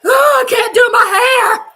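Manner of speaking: loud, high exasperated cry; tense shout. Real, not acted. Fan too nervous to finish her hair, loud shout. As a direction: shout it out mid-panic.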